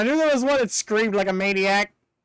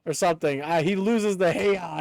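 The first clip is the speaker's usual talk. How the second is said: ordinary talk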